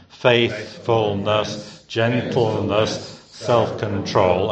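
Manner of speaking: faithfulness, gentleness, self-control each dragged out slowly, syllable by syllable; over-pronounce every syllable in a laboured, plodding way; laboured trisyllabic. Shown as a demonstration. Preacher mocking a laboured recitation, slow and plodding. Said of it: "in that sort of laboured, trisyllabic manner".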